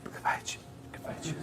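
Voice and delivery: barely audible whispered audition line; timid little girl. Acted. Another girl auditioning for casting, tiny shy whisper. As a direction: whisper it falteringly, like a shy child. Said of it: "And there's another girl".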